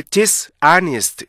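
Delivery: "디스아네스트" as two words, dis and honest; mistaken split reading. Shown as a demonstration. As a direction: break after 디스, then say the rest afresh. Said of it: "이렇게 읽는 게 아니고". ("디스아네스트"를 디스/아네스트로 끊어서)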